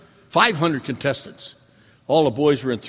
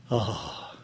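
blissful sigh; 3 semitones lower, much slower than usual. Retold or imitated. Savoring remembered drink.

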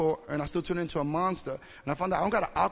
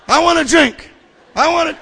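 loud, high-pitched shout; acted craving outburst; 10 semitones higher, 15 dB louder than usual. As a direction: blurt the urge out at full volume. Acts out craving bursting out.

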